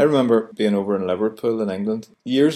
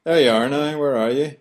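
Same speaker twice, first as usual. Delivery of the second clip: higher, rambling Belfast chat; chatty Belfast talk; 4 semitones higher than usual. Shown as a demonstration. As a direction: natter on Belfast style. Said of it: "For example".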